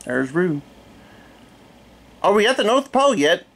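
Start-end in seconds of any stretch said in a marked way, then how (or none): 2.2-3.5 little Roo voice. A narrator's reading. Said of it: "asked Roo".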